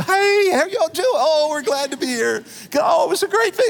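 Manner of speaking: high-pitched, gushing mock politeness; fake cheerful greeting. Couple who fought all the way in greeting people at church, fake cheerful. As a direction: put on an exaggerated sing-song church hello.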